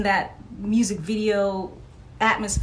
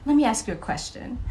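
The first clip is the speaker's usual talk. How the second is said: quoting Michael; 4 semitones higher than usual. Retold or imitated. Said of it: "these were his exact words too".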